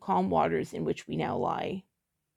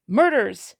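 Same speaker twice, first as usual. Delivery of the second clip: snarled correction; 2 semitones higher, 7 dB louder than usual. A narrator's reading. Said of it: "a snarl in his voice".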